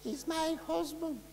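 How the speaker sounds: high, very soft far-away wife; imitated distant voice. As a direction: mimic a faint voice from way off. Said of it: "this voice away in the distance".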